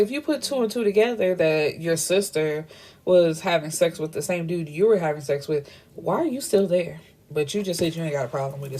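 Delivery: usual speaking voice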